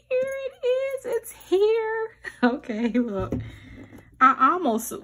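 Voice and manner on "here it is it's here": much higher, thrilled announcing; excited reveal. Real, not acted. Showing the keyboard to viewers, thrilled high reveal. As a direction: squeal it's finally here.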